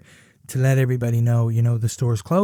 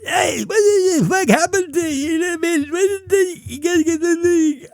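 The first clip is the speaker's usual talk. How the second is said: put-on deaf accent. Cashier confronting Dave, high mock voice. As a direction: pitch it way up and blur it. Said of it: "she's like".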